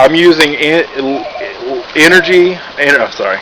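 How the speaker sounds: stumbling over brand names, restarting words; real fumbled false starts with a quick apology; tongue-tied. Real, not acted. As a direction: trip on the product name and correct yourself. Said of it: "I'm tongue-tied today".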